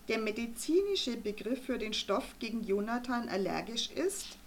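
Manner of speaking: plain reading voice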